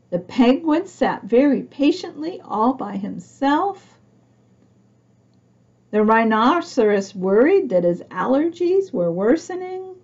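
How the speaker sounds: usual speaking voice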